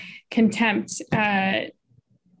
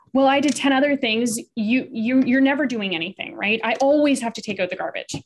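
quick, raised-pitch protest; defensive; 4 semitones higher than usual. Shown as a demonstration. Partner answering criticism about the garbage, defensive. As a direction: fire back with indignant excuses. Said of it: "Defensiveness would be".

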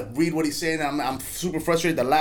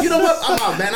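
fed-up exit; 6 semitones higher, 6 dB louder than usual. Retold or imitated. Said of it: "I'm like".

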